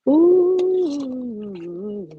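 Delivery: long, loud appreciative "ooh"; delighted ooh. Real, not acted. Showing viewers her split pea soup, delighted ooh. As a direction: let out a drawn-out ooh.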